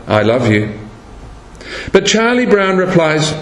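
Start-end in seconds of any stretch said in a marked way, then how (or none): 0.1-0.7 low, understated retold declaration. Lucy's first try with her brother, low and understated. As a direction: say it low, at normal volume. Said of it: "she says to her brother".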